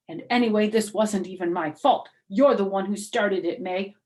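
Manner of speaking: put-on loud, blaming kid's voice; accusing. Junie blames May in front of the teacher, loud and accusing. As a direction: point the finger hard.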